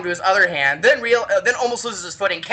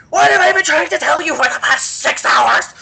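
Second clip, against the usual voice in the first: high, loud outraged shout; crazed exasperation; 10 semitones higher, 6 dB louder than usual. Retold or imitated. Orc expert to clueless animators, crazed exasperation. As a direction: blow up in comic exasperation. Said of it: "I literally went crazy".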